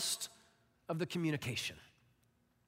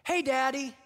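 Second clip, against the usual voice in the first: put-on child calling dad; 6 semitones higher, 3 dB louder than usual. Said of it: "the first few words I hear".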